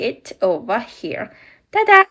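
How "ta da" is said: high, bright reveal with a burst of volume; excited ta-da. Real, not acted. Showing viewers the finished castle, excited.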